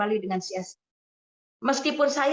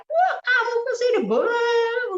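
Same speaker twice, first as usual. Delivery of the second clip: high-pitched mock shouting; high, drawn-out retold yell; husband's yell; 12 semitones higher, 3 dB louder than usual. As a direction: imitate an outraged husband yelling. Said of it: "suaminya langsung teriak".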